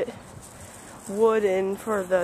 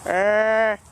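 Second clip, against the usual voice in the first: barking; 5 dB louder than usual. Pretend. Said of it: "I was barking".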